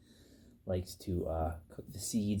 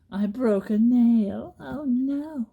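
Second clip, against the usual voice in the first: squealed, drawn-out mock dismay; high dramatic wail; 10 dB louder, much slower than usual. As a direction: wail it like a tiny tragedy. Broke a nail, dramatic.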